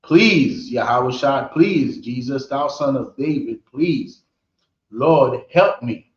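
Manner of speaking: mild pleading, emphatic cries of please; begging. Acted. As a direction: plead insistently, acting out a desperate supplicant. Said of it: "But she's begging him. She's like".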